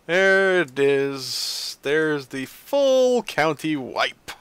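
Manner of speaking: very slow, stretched-out words; drawn-out exasperation. Real, not acted. Player seeing the full county wipe, drawn-out exasperation. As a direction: drag out each word in exasperated announcement.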